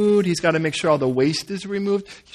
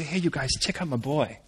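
soft, lower, understated brag; God showing off; 4 semitones lower, 10 dB quieter than usual. Acted. God presenting Joseph to everyone, quiet pride. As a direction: quietly point out a favourite son. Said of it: "As if God were to say".

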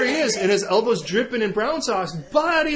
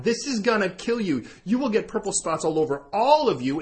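ordinary talk

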